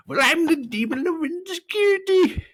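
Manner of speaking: squeaky-high, loud mock sobbing. A narrator's reading. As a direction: wail like a tiny creature found out. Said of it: "he sobbed".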